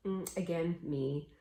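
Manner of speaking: usual speaking voice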